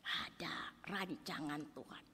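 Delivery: slow, hushed whisper. Acted. Preacher tells the church of God's plan, whispered. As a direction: whisper it like a secret truth.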